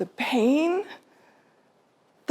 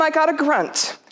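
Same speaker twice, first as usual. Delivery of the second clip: ordinary talk, nothing marked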